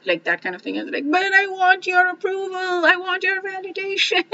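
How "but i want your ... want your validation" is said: high, pleading whine, close to tears; mock desperate plea for validation; needy whine. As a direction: beg for approval in a high crying voice.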